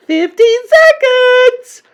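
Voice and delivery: very high, slow countdown screech; witch crying out. A narrator's reading. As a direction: call out the time like a shrieking witch. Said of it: "cried the Grand High Witch".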